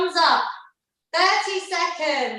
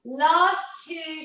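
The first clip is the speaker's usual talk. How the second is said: ordinary delivery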